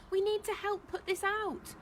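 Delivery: very high, urgent appeal; crying out. A narrator's reading. Chaya urging them to fight fire, crying out. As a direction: call out for action, high-pitched. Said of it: "cried Chaya".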